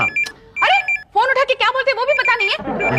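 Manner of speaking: high, quick telling-off; exasperated scolding. Acted. Clerk chiding visitor about answering the phone, exasperated.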